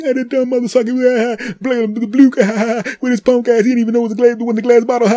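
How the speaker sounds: high, loud jeering voice; mocking crowd. Crowd jeering at the guy with the plastic bottle, high and loud. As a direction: jeer at the fool. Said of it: "And everybody's like".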